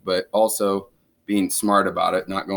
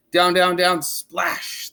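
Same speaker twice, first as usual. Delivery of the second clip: higher, building down-down-down; falling into the river; dramatic descent; 6 semitones higher, much slower than usual. A narrator's reading. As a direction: narrate the plunge. Falling narration.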